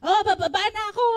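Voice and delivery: loud high call down the house; calling out loudly. Retold or imitated. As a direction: yell across the house, mock-heated.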